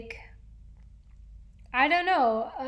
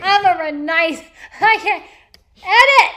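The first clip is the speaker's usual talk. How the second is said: excited; 6 semitones higher, 12 dB louder than usual. Real, not acted. Catches herself, laughing embarrassed.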